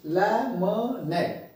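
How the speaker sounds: लमनेट slowly split into separate syllables. Shown as a demonstration. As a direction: break लमनेट apart, one syllable at a time. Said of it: "अलग-अलग syllables को". (लमनेट को अलग-अलग syllable में, धीरे)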